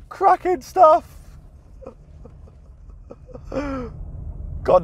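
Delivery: high, delighted laugh; laughing with glee. Real, not acted. After driving through deep puddle.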